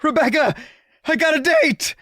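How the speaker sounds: high, loud, thrilled call; excited shout. Acted. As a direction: burst out with the big news. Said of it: "with an enthusiasm".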